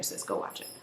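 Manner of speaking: very soft, breath-only whisper; hushed aside to a friend; whispered urging. Real, not acted. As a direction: whisper it like a confidential nudge.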